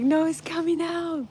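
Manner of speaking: high-pitched retold protest of a panicking son; mock panic. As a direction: imitate an alarmed kid, pitched well up. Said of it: "he started panicking".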